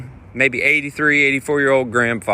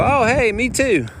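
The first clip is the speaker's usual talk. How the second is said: casual chirpy reply; 11 semitones higher than usual. Retold or imitated. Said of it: "I just naturally go".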